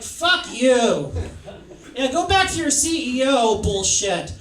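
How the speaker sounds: high, loud, hostile rejection; hammed-up furious group rebuke; loud mock outrage. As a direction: tell him off with full-volume scorn. Women angrily rejecting him.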